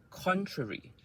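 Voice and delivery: contrary with a high first syllable, falling away; word stress demo. Shown as a demonstration. Teacher models contrary for learners, stressed on high CON. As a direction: hit CON in contrary high, then drop. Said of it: "part of the word that is stress".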